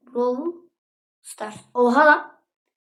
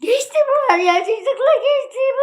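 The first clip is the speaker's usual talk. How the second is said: very high, wobbling comic whine; hammed-up high wailing; high-pitched wail; 12 semitones higher than usual. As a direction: squeal it out far above normal pitch. Mock wailing after the scream.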